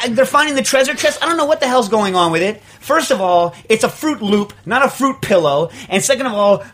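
high, worked-up, indignant tirade; ticked-off rant. Real, not acted. Host lectures the advertisers about the toucan's nose, ticked off. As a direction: rail about it, hitting key words hard. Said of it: "what really ticks me off".

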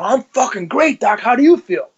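high-pitched, punchy comeback, retold; brash retort. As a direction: throw back a brash, cocky answer, pitched well up. Acted brash answer to worried doctor.

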